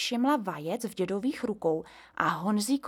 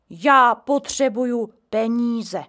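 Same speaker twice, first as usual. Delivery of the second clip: put-on old man voice; 3 semitones higher, 8 dB louder, slower than usual. A narrator's reading. Grandpa complains.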